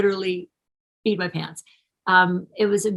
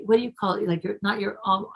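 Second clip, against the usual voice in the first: usual speaking voice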